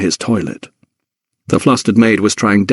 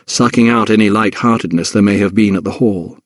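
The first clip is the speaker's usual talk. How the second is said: plain reading voice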